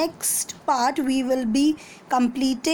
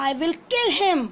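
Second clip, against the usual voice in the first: angry; 4 semitones higher, much faster than usual. Acted. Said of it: "He was very angry".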